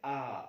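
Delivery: full open आ demo; open-mouth vowel. Shown as a demonstration. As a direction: open wide and say आ. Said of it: "पूरा मुंह खोल के करते हैं".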